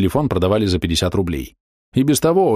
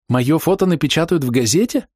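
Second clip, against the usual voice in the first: delighted; 7 semitones higher than usual. A narrator's reading. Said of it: "восхитилась она".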